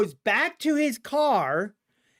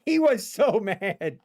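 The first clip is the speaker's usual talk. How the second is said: plain reading voice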